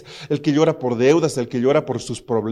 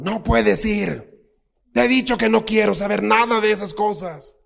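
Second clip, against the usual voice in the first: stern father; 7 semitones higher than usual. Retold or imitated. Father refuses his daughter, in a hammed-up stern voice. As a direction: play the strict dad saying no.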